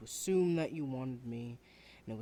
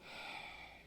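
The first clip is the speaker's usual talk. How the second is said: breathy sigh; 11 dB quieter than usual. Acted. Student sighs before giving up.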